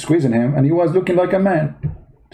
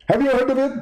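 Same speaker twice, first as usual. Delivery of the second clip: high, indignant preacher voice; Zakir Naik impression; 10 semitones higher, faster than usual. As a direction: play the offended debater, pitched well up. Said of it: "Zakir Naik?".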